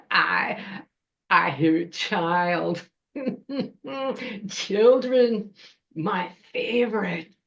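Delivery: hungry witch savoring each word, chuckling; drawn-out troll-witch voice with a cackle; gloating troll witch. Acted. Troll witch scenting hidden children, gloating and chuckling.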